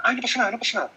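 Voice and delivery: mock anxious parent soothing a crying child; anxious rushed comfort. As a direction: rush the comfort, a touch anxious. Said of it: "Muy ansioso".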